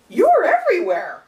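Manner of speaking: high, loud imitation of an amazed passerby; mock amazement. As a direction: exclaim it as if spotting them yet again. Acts out passerby recognising her.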